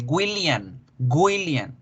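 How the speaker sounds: "William" said as GÜI-lian, ending in N; hard G in front, N at the end of "William"; mispronounced with N. Shown as a demonstration. Teacher showing the common mistake, "William" as GÜILIAN. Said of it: "incluso con N".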